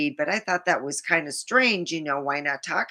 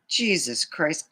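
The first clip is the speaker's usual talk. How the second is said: ordinary talk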